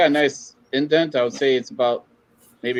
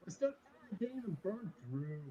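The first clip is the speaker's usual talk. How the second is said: muffled, mic covered by finger; finger over the mic; 7 semitones higher, 22 dB quieter than usual. Real, not acted. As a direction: speak with hand over mic. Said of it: "You're covering your mic".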